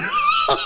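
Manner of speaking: high squealing laugh burst; real shrill giggle. Real, not acted. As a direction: let out a high shrieking laugh.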